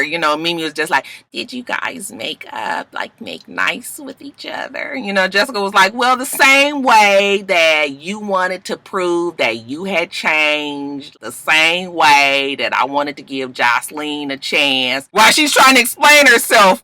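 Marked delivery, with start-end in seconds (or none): 1.3-5.0 very soft, slow mock innocence; delicate probing. Mimi gently prodding Jessica, delicate probing. Imitating Mimi's delicate question. 5.9-15.1 very slow, drawn-out imitation; deliberate explanation. Jessica justifying herself to the table, deliberate.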